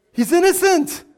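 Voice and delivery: ringing, high-pitched verdict; emphatic proclamation. Real, not acted. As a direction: call out the answer to the congregation. Preacher proclaims answer to congregation.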